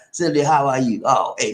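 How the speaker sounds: ordinary talk, nothing marked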